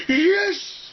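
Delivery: high, loud victory shout; loud triumphant cry; triumphant yell. Retold or imitated. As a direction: shout it holding a sword aloft. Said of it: "held it aloft and went".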